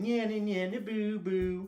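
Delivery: high, loud playground singsong; singsong taunt. Shown as a demonstration. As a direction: tease like a kid in the schoolyard. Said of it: "Can you do that again".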